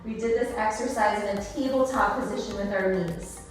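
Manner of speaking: normal talking voice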